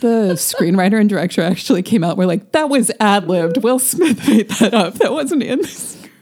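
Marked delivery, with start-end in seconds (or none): 2.5-6.1 mock defensive. Mocks filmmakers' hasty public disclaimer.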